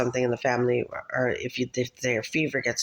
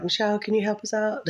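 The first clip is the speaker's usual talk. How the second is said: lightly voiced higher pleading request; neighbors asking a favor; 8 semitones higher than usual. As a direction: imitate neighbors asking for help. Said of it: "and they're like".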